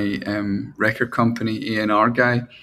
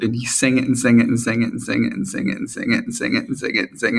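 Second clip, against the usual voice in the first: rapid repetition; faster than usual. Real, not acted. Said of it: "I mean, I'm talking".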